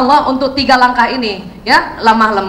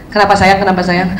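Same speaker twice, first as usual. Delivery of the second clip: gentle coaxing; 7 semitones lower than usual. Shown as a demonstration. Gentle mother asking child.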